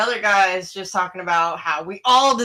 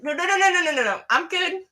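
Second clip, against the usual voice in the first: high string of rapid-fire no's; hurried refusal; 8 semitones higher, faster than usual. Retold or imitated. Driver turning down the drunk passenger, high-pitched retold protest. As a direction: wave him off in a raised pitch.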